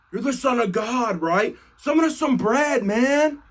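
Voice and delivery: high-pitched wheedling; pestering. Retold or imitated. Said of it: "He is pestering him relentlessly".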